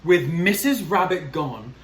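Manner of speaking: plain reading voice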